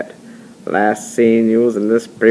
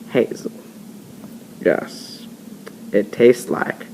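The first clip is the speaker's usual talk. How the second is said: usual speaking voice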